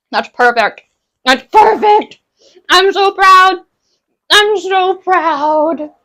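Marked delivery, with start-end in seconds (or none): 1.2-2.2 high, loud squeal of delight; overjoyed. Real, not acted. Delighted with her cooked food. 2.7-5.9 high, loud squeal stretching into a long drawn-out "proud"; gushing pride. Real, not acted. Gushing over her own cooking.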